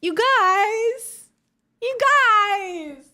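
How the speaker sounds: high and loud, bursting with joy; excited cry. Real, not acted. Streamer calling to her chat about the gift, loud thrilled cry.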